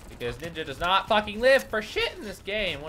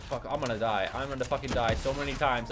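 normal talking voice

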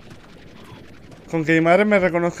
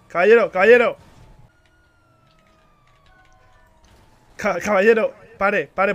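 calling out; 4 semitones higher, 8 dB louder than usual. Real, not acted. Calling out to a driver.

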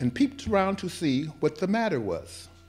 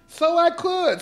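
delighted tiger; 12 semitones higher, slower than usual. Acted.

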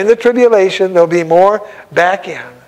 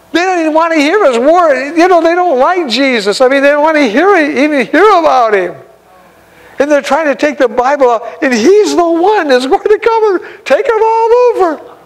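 fervent preaching; 8 semitones higher than usual. Real, not acted. Fervent preaching, raised high voice.

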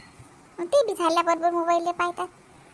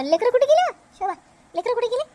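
higher, louder call across the house; 8 semitones higher, 5 dB louder, faster than usual. Acted. Grandmother calling for the missing children, loud call.

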